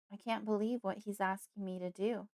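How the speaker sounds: usual speaking voice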